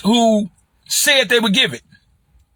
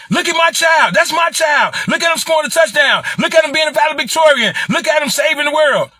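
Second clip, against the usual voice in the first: mock proud parent; 8 semitones higher than usual. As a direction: show off the child like a boastful parent. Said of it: "parents are so arrogant".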